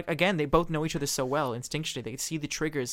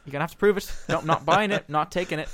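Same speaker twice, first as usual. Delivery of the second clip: louder, higher insistence; defiant refusal; 4 semitones higher, 7 dB louder than usual. Retold or imitated. Valine daring Coleman to prove it, raised voice. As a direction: push back hard, refusing to believe.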